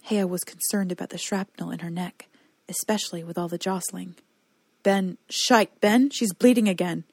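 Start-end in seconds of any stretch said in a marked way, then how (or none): 4.8-7.0 loud panicked yell; frightened shouting. Acted. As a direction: shout it in alarm. Alarm as the princess bleeds.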